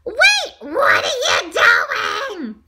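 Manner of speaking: high, drawn-out alarmed shout. A narrator's reading. Donut realizes he may be bitten, shouting. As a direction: shout it slowly, high and loud. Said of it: "Arnie shouted".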